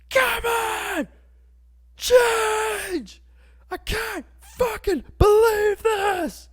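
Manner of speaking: high, top-of-the-lungs desperate scream. Acted. Desperate driver screaming at a red light to change, screaming. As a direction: scream it full out, begging. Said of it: "I'm screaming all of this, top of my lungs".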